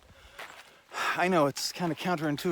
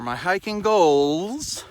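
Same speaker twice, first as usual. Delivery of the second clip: announcer reveal; 3 semitones higher, 7 dB louder, much slower than usual. Acted. The moment you've been waiting for.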